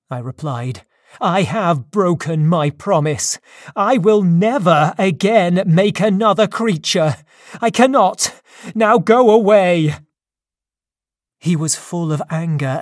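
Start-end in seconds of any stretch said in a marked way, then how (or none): 1.2-9.9 loud, rising, higher defiant refusal; defiant shout. A narrator's reading. Victor refusing the monster, loud defiant shout. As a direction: throw the refusal back at him, louder and higher.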